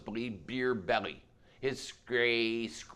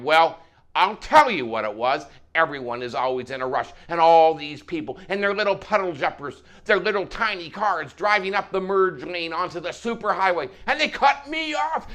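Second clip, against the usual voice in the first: loud, indignant trucker tirade; worked-up, raised-voice complaint; animated trucker rant; 4 semitones higher, 6 dB louder than usual. Acted. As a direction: rant with mounting outrage to a high peak. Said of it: "The trucker became even more animated and louder".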